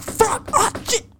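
high squeal of pain; hammed-up high yelp; retold panic. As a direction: yelp like you just got stung. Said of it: "I'm like".

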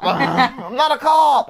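slow, high mock Boston; drawn-out, hammed-up Boston attempt; bad Boston accent. Host failing at an actor's accent, slow bad Boston. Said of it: "I can't do a Boston accent".

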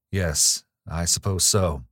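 ordinary delivery

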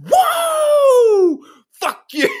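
mock victory yell. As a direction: jump up and scream it at the fan. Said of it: "standing up in your booth and being like".

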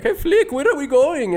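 high, hammed-up little bug calling out to Flick; a lost little bug asking the leader where they're headed; mock bug voice.

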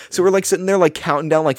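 ordinary talk, nothing marked

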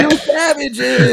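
high, drawn-out indignant cry; hammed-up appalled accusation; mock outrage. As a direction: stretch it out, scandalised.

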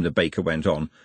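ordinary delivery

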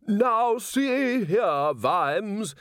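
high, slow indignant priest voice; angry priest. A narrator's reading. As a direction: scold like an outraged priest.

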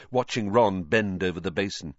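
plain reading voice

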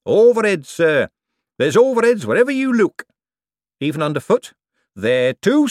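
put-on a put-upon old foreman listing his woes; Shady the foreman. Shady's long grumbling costing speech.

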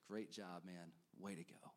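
low, soft, tender approval; a gentle pat on the back; quiet praise. Retold or imitated. Jesus imagined praising Peter, soft tender approval. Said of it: "I can imagine Jesus".

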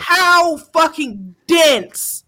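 slow scornful outburst; disgusted. Real, not acted. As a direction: drag it out in disgust. Outraged rant.

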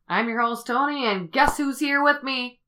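show host intro. Acted. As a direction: welcome listeners like a radio host. Podcast host opening.

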